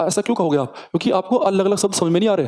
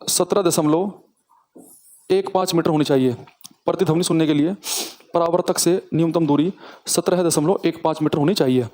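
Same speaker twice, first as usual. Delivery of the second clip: slow dictation. Shown as a demonstration. Teacher dictating the rule slowly to class. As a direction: dictate slowly, phrase by phrase, for notes. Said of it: "तो वो दूरी मैं लिखवा देता हूँ".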